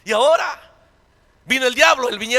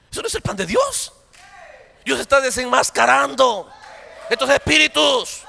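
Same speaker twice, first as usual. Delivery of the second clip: preaching at full force. Acted. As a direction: drive it home to the congregation.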